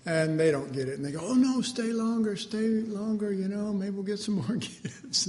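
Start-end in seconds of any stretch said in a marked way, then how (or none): 1.2-5.3 high, loud coaxing plea; excited family urging stay longer; eager pleading. Retold or imitated. Family begging servant to stay.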